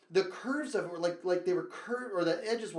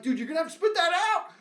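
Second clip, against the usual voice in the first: fast, high, louder urging; 11 semitones higher, 7 dB louder, faster than usual. Retold or imitated. Boy pressing his friend, fast high urge. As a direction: hurry him to spit. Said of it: "And I'm like".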